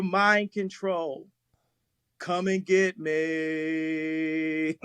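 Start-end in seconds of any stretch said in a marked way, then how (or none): 2.2-4.8 slow, stretched-out dare; drawn-out challenge. Real, not acted. Speaker daring his enemies after naming them, drawn-out challenge. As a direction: drag the last word long and level. Ends statement with defiant challenge.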